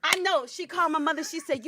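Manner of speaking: usual speaking voice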